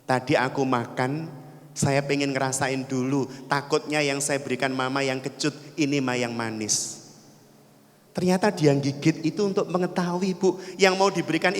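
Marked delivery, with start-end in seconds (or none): 0.1-7.1 low, soft gentle explanation; lightly voiced child. Child offers mother the sweet apple, lightly voiced gentle explanation. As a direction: explain softly to mother, in lightly acted innocence. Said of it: "Apa kata anaknya?".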